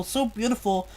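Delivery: ordinary delivery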